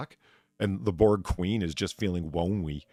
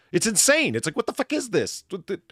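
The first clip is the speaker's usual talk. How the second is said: higher and louder in disbelief; exasperated outburst; 11 semitones higher, 5 dB louder than usual. Real, not acted. Ranting to his cohost about the show, higher and louder. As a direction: throw up your hands at the plot.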